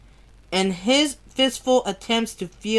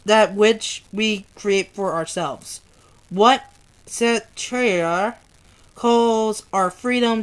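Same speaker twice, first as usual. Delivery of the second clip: ordinary delivery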